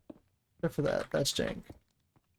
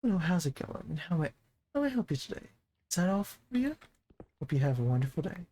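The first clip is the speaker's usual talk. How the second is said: customer service voice. Acted. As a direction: play the overly polite shop clerk. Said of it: "the customer service voice".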